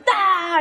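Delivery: high, wordless mock outburst; mock exasperated cry. Mom loses it over the car, high mock cry. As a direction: burst out like a scandalised mother. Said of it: "she starts shouting".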